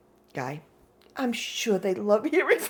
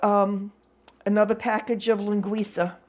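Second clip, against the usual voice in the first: normal talking voice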